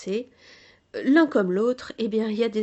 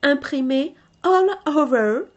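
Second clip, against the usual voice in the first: "all over" said again in English, a bit louder; English accent; 3 semitones higher, 5 dB louder than usual. Shown as a demonstration. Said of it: "mon accent". ("all over" répété avec l'accent anglais)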